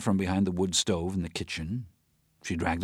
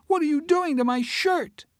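put-on high child voice, louder and insisting; a little girl pressing her question; insistent child; 7 dB louder than usual. Emma repeats it louder to Teresa, insistent.

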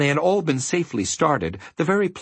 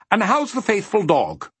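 friendly gentleman; 9 semitones higher than usual. A narrator's reading.

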